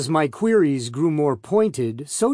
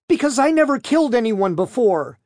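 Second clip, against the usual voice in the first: higher, sharp retort; sniping; 9 semitones higher, 4 dB louder than usual. A narrator's reading. Defendant answering the prosecutor's challenge, sniping. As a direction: snipe back, pitched well up. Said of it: "she sniped".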